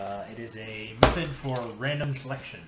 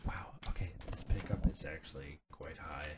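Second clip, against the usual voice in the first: ASMR whisper; 7 semitones lower, 6 dB quieter than usual. Pretend. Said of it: "Today on this A S M R episode".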